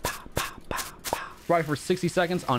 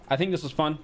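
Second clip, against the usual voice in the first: normal talking voice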